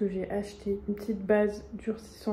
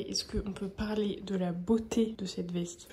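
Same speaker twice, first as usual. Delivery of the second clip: whispering. Real, not acted. Whispered aside about jacket.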